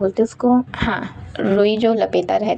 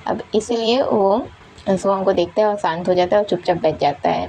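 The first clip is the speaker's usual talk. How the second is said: ordinary delivery, nothing marked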